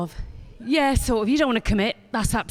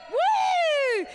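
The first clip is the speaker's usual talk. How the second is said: woo. Pretend. Compere hyping the crowd.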